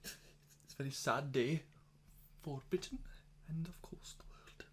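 slow hushed mock grief fading to a whisper; tongue-in-cheek sad announcement; mock solemn mourning. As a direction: play it like a sombre eulogy, sinking to a whisper.

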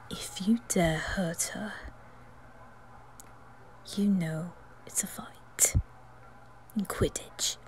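slow, whispered warning; slow whispered warning to Malfoy; whispered threat. Acted. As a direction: threaten him under the breath.

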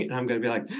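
normal talking voice

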